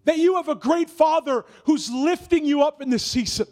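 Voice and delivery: rising prophetic prayer, high and loud, shouting at the peak; preaching at full force. Real, not acted. Pastor prophesying over the congregation, building to a shout.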